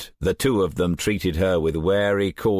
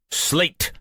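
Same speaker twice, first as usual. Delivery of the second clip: higher, menacing call; dangerous tone; 10 semitones higher than usual. A narrator's reading. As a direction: warn him with his name. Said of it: "a dangerous tone to his voice".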